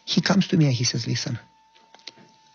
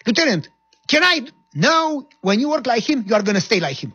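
higher and louder complaining soldier; whiny soldier; 8 semitones higher, 3 dB louder than usual. Retold or imitated. Soldier complaining to the lieutenant, high indignant voice. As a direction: protest it, a bit indignant.

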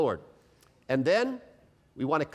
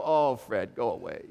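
weary brush-off; 5 semitones lower than usual. Retold or imitated. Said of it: "We're just so tired".

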